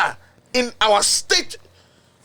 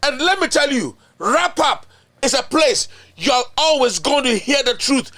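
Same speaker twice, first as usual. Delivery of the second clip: ordinary delivery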